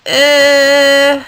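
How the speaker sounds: high, loud elephant-like eh; a noncommittal elephant grunt; undecided eh. Acted. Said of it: "He only answered".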